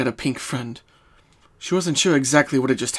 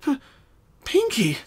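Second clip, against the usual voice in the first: shocked; 12 semitones higher than usual. Acted. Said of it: "Twilight said in shock".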